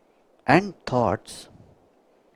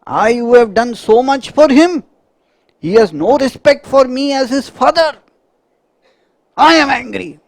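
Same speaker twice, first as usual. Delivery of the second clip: high, loud, affronted father ranting about his son; outraged father voice; indignant father; 10 semitones higher, 5 dB louder than usual. Acted. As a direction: rant like a wounded father whose son disrespected him.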